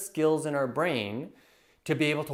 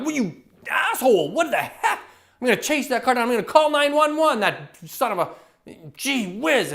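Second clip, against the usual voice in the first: mock furious yelling at the driver, higher and louder; retold road rage; 9 semitones higher, 6 dB louder than usual. Coach reenacts yelling at a driver who cut him off. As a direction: ham up the rage at a reckless driver.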